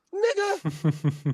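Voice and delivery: high, stretched-out mock outrage; exasperated. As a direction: stretch the word in disbelief. Exasperated punchline, pitch leap.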